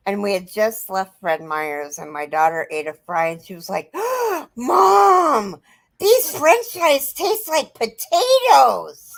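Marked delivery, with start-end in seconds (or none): 3.9-9.1 put-on high, slow, amazed little girl; excited child. A mother imitating her little daughter's amazed discovery, high and slow. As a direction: gasp it out like a wide-eyed child.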